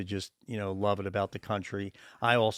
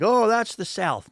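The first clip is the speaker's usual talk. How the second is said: dismissive mock voice; 11 semitones higher, 7 dB louder than usual. Mimicking people who lump regions.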